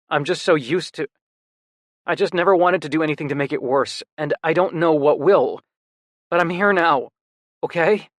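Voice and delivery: a hint of a shaken brother promising to stay; upset younger brother. Brother voiced in story.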